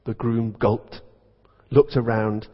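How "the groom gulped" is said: ordinary talk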